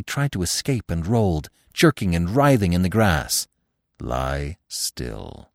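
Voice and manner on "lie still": put-on low, soft command from an old stag; a hushed order, kept low. A narrator's reading. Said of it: "the old stag commanded".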